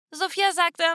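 plain reading voice

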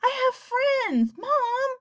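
high, slow pleading; pleading whine. A narrator's reading. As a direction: beg like an upset kid. Boy pleading with parents.

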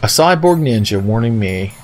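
ordinary talk

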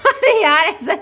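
high, laughing objection; amused, pitch jumping up; laughing protest. Real, not acted. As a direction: object through real laughter. Laughing protest at partner's teasing.

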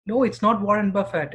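ordinary talk, nothing marked

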